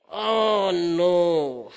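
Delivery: loud, drawn-out mock wail; hammed-up groan of protest; comic dismay. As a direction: wail in exaggerated dread. Retold dismay at God's demand.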